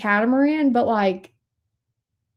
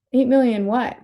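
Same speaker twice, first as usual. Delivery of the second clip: ordinary delivery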